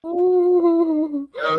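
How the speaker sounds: loud high no. Real, not acted. Correcting the fish name.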